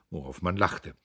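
plain reading voice

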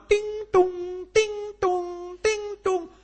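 high sing-song ding-dong; doorbell chime. Retold or imitated. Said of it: "ada bel rumah".

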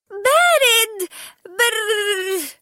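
very high, slow cold shriek then brr; shivering shriek. A narrator's reading. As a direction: squeal from icy water. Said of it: "بيد أنها صرخت".

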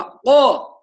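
قا overdone and forced; a grotesquely forced قا; exaggerated qaf. Shown as a demonstration. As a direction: strain قا past the right strength. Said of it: "يبالغ فيها فيخرجها بطريقة بشعة".

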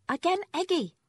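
put-on high, loud toddler demand; high toddler command. A narrator's reading.

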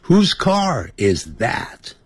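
慢速念 Whose car is that; 放慢示范问句; slow teaching read. Shown as a demonstration. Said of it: "你就可以改成". (教学式慢读问句)

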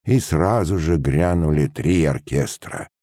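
ordinary delivery, nothing marked

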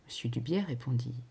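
ordinary delivery, nothing marked

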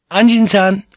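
high, loud, cheerful call; bright courtesan's call. A narrator's reading. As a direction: call out gaily in a girlish voice. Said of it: "Kiku called out gaily".

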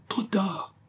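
soft, high, fading voice; faint murmur. A narrator's reading. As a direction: murmur it weakly as you go under. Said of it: "murmura le jeune homme".

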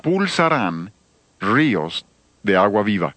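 pulsarán ríos de agua viva drawn out slowly; slow emphatic quote. Shown as a demonstration. Preacher offers his better translation, slowed and weighty. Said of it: "la mejor traducción sería".

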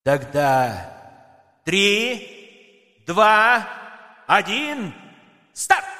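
countdown. Acted. Countdown to start.

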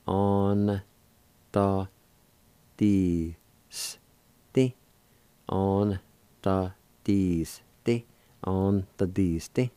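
"On, ta, di, s, ti" spoken syllable by syllable, with a pause after each; teacher drilling "On, ta, di, s, ti" for listeners to copy. Shown as a demonstration. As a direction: sound out "On, ta, di, s, ti" carefully, said three times. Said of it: "pronounce the N sound with the A like this".

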